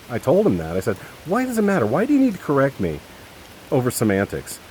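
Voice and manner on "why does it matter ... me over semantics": high, loud exasperated challenge; pissed off. Retold or imitated. Son challenging his father over semantics, pissed off. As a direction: retell the protest, really fed up. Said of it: "I was pissed".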